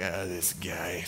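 low, slow, soft grumble; weary disdain. Acted. Ahab seeing Micaiah walk in, low weary disdain. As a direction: groan it under your breath.

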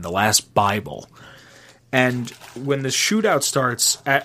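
ordinary delivery

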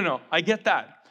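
normal talking voice

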